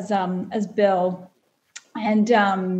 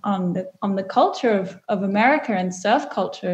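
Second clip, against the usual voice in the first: usual speaking voice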